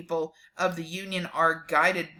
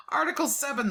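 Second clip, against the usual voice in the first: laughing breathily, fast and higher; 9 semitones higher, 4 dB quieter, faster than usual. Real, not acted. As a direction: giggles through the heading. Laughs while starting article.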